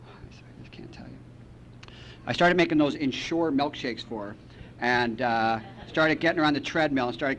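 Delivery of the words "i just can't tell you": hushed whisper, much softer; whispered secret. Retold or imitated. Son whispering to his mom that he can't reveal it. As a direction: confide it under the breath.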